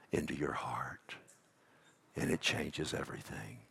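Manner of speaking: hushed, breathy whisper of reassurance. Acted. Pastor to his hurting listeners, dropping to a whisper. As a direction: let the words fall to a whisper. Tender close of sermon.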